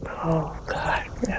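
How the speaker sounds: hushed, horrified whisper. Retold or imitated. Horrified sister reacting to the family's dinner squabble, horrified whisper. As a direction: whisper it in retold dismay. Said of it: "She was horrified".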